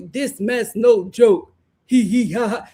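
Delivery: lightly voiced caveman, dopey laugh at the end; mock the simple caveman talk; caveman impression. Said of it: "Very caveman".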